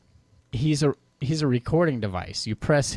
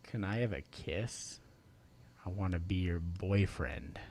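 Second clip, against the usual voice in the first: creepy low voice; 4 semitones lower, 13 dB quieter than usual. Shown as a demonstration. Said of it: "the creepy low pitched".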